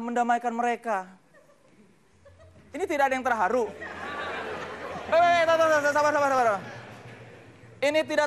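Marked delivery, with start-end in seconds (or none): 3.7-6.6 urgent calming. Acted. Calming a quarrel on stage.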